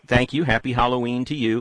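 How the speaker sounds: ordinary talk, nothing marked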